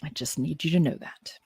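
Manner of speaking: quiet, held-back calm; forced calm. Real, not acted. Teacher calming herself before her students, quiet. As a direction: keep it level and restrained. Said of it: "let me be very calm now".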